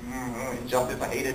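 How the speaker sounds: low, quiet contained anger; seething. Acted. Superman watching the kid get bullied, quietly seething. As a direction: hold the mock fury in under your breath. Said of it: "like, seething".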